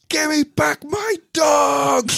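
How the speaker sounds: high, slow wailing scream; child screaming. Retold or imitated. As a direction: wail it like a crying child. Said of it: "broke down into tears and screamed".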